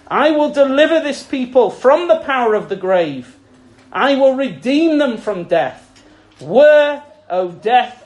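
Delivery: loud ringing proclamation, swelling on each Where; scripture read with force. Acted. Preacher reading Hosea to the church, loud proclamation. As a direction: proclaim the verse like a herald.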